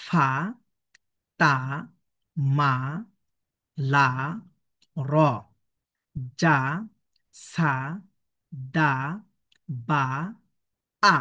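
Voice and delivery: "ফা", "তা", "মা" as separate open syllables; one letter sound at a time, long pauses between; measured recitation drill with fatha vowels; slow syllable reading. Shown as a demonstration. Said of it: "এভাবে এখান থেকে নিয়ে এই পর্যন্ত ফাতহা দিয়ে উচ্চারণ করবেন".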